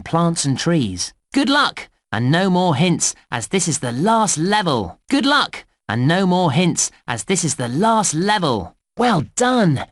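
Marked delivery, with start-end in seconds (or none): 1.3-1.9 high-pitched, upbeat well-wishing; rousing send-off. Acted. Game guide sending the player into the last level, rousing send-off. 5.1-5.6 high-pitched, upbeat well-wishing; rousing send-off. Acted. Game guide sending the player into the last level, rousing send-off. 9.0-9.9 high-pitched, lively praise; congratulating. Acted. Game guide praising the player after the inspector's visit, congratulating.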